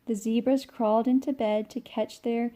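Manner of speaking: put-on lively read-aloud for children; bright storybook narration; storytime reading. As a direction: read it like a picture book to kids. Reading picture book aloud.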